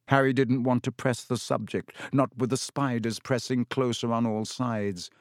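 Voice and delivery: plain reading voice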